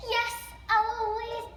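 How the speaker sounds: drawn-out, lightly voiced room-service answer. A narrator's reading. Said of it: "They always know it's me and say".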